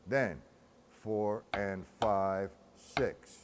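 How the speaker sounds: slow, evenly measured count; steady rhythmic counting; counting the figure. Shown as a demonstration. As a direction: count each beat out in strict time. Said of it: "with the figure".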